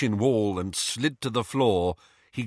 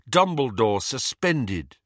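ordinary delivery

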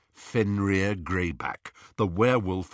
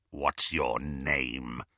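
low, very slow, soft snarl; 7 semitones lower, 5 dB quieter, much slower than usual. A narrator's reading. Werewolf questioning captive Harry, low snarl. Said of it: "snarled Greyback".

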